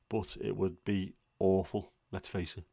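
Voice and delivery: ordinary talk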